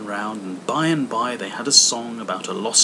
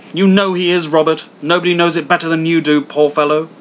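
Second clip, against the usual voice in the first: higher, loud indignant tirade; wife railing at Scrooge to Bob, higher and loud; bitter wife; 5 semitones higher, 9 dB louder than usual. Acted. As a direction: spit out every insult. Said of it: "wife rails at Scrooge".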